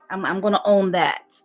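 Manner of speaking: ordinary talk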